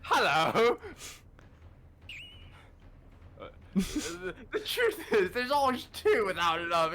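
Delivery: put-on Southern accent. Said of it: "he Southern or is he Scottish?".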